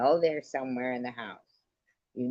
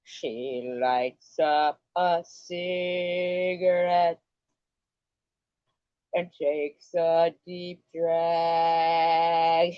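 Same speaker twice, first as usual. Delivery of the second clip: slow, drawn-out storyteller voice; mock dramatic narration; 3 semitones higher, much slower than usual. Narrating the viewer's morning in slow mock drama. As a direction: narrate it slow like a moody movie.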